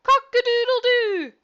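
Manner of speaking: very high, loud, slow rooster crow. Acted. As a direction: crow it out like a cockerel. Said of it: "who crowed".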